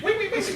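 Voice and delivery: mock squeal. Mimicking horseplay nipple tweak.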